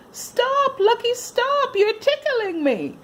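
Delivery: high, loud giggly protest; laughing shout. Acted. Tatiana shouting at the puppy licking her face. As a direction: squeal it through the tickles. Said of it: "she shouted".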